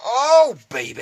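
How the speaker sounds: very loud, high-pitched whoop; hammed-up delight. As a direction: belt it out high in mock ecstasy. Hamming up delight at the parts.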